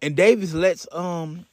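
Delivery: usual speaking voice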